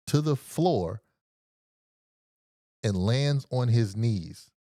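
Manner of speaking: ordinary delivery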